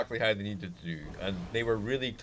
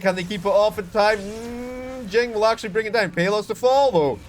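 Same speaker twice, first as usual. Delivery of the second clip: excited; 9 semitones higher, 6 dB louder than usual. Real, not acted. Caster peak of the fight.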